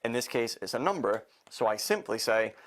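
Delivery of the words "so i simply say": usual speaking voice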